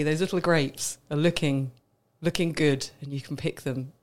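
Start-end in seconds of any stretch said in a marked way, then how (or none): none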